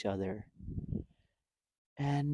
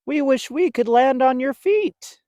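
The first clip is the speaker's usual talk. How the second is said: much higher, louder wish; wistful bird voice; 12 semitones higher, 10 dB louder than usual. Acted. Flitter wishing to land like a bat. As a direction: sound a little longing. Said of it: "Flitter replied".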